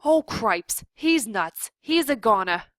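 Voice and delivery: loud, frantic alarm; panicked shout. A narrator's reading. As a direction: cry out in a panic. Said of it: "shouted Furlow again".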